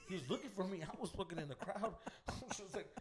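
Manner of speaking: ordinary talk, nothing marked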